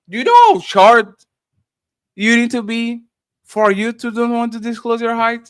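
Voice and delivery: a hint of a preachy lecturer pressing the question; Doctor Umar Johnson. Streamer repeats a chat question in a mild lecturer impression. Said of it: "three times like Doctor Umar Johnson".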